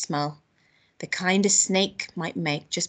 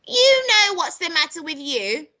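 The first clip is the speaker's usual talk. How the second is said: high, loud, slow scolding; scolding grandma; 9 semitones higher, 7 dB louder than usual. Acted. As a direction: berate the boy, drawing it out loudly. Grandma berating George in story.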